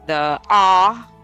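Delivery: a held, isolated "ah" vowel; ah vowel demo. Shown as a demonstration. Teacher lists vowel sounds for drills, ah held long. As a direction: sound out "ah", drawn out. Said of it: "you start with short O, long O".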